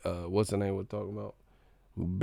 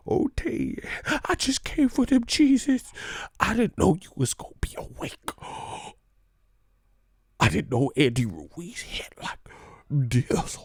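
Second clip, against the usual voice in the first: high put-on nervous servant voice; hammed-up meek excuse; mock servile voice; 5 semitones higher, 4 dB louder than usual. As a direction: play the cowed fighter pleading with his master. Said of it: "and then Massa walk by".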